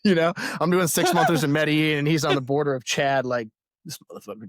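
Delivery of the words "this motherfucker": very soft, low seething imitation; muttered mock grudge. Imitating the resentful dude in Sudan.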